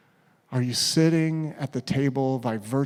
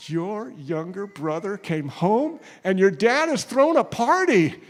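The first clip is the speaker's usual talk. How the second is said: excited news; 5 semitones higher, 4 dB louder than usual. Acted. Servant announcing the son's return.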